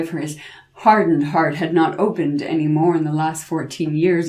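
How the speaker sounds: plain reading voice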